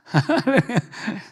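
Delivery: high falling laugh; amused high chuckle; bright laugh. Real, not acted. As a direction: let a short laugh spill out. Pastor laughs at congregation.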